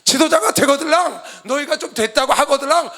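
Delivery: loud, high-pitched warning to leaders; impassioned preaching shout; forceful exhortation. Real, not acted. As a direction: shout each condition out from the pulpit.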